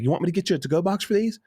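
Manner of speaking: high, fast waiter imitation; eager waiter. Waiter asking about a to-go plate, eager mimicry.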